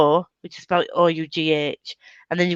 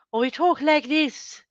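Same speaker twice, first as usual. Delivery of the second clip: put-on much higher, lighter caricature British accent; 7 semitones higher, 4 dB quieter than usual. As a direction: other extreme of British accents, pitched up. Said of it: "there's nothing in between".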